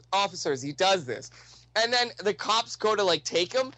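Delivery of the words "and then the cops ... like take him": ordinary talk, nothing marked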